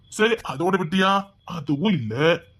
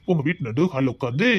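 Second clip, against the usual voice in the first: ordinary talk, nothing marked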